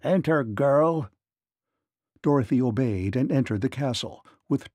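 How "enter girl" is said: slow, stern giant's order; stern, commanding. A narrator's reading. Giant orders the girl inside, sternly. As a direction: command it slowly and firmly. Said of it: "in stern, commanding tones".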